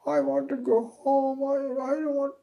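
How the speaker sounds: very high, whiny voice of the drugged student; delirious refusal. Retold or imitated. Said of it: "he says".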